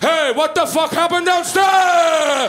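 loud, high, drawn-out imitation of Dad; yelling dad. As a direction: bellow downstairs like a woken father, stretching the end. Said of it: "just my dad going".

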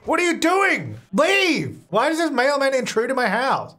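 high, outraged shouts; exasperated yelling. Real, not acted. Player orders mailman out of house, high exasperated yelling. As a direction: order them out in a high yell.

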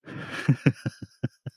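soft unvoiced laughter; breathy laugh. Real, not acted. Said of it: "Ich muss lachen".

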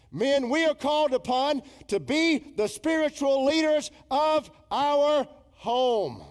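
loud, high-pitched exhortation from the pulpit; forceful preacher's rebuke; full-force preaching. Real, not acted.

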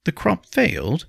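high, surprised echo; startled question. A narrator's reading. Nobleman asking about the failed crop, startled. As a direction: throw it back as a startled question. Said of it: "Henry asked".

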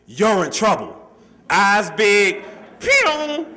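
high, loud mock-dramatic sister; overdramatic sister. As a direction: ham up the sister zipping past the door. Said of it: "my sister is overdramatic".

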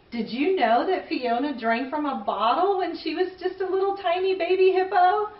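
plain reading voice

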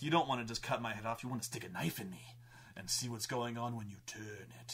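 low, taunting action-hero impression; mock-heroic Commando taunt; Arnold Schwarzenegger. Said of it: "like, an Arnold Schwarzenegger Commando".

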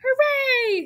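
very high, held cheer; crowd yell. A narrator's reading. Fair crowd seeing Wilbur revive, high cheering yell. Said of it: "yelled the crowd".

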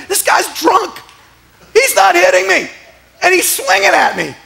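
high-pitched incredulous exclaiming; comic, high-pitched amazement; acted astonishment. Acts out realizing the attacker misses.

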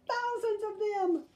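put-on high frightened little-girl wail; scared wail. Sister tells Brother her fear, high wail. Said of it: "she wailed".

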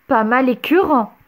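mildly disgusted grandpa; grimacing disgust. A narrator's reading. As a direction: pull a face while saying it. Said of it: "insista son grand-père en grimaçant".

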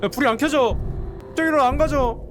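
높고 다급하게 쏟아내기; 불이 꺼져 당황해 소리치기; 겁먹은 고함. Real, not acted. (점점 높아지는 겁에 질린 목소리)

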